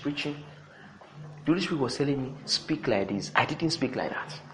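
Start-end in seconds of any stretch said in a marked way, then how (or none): 2.4-3.3 very soft, low quoted voice; hushed divine prompting. Retold or imitated. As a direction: retell an inner prompting, softly and low. Retold voice of Holy Spirit.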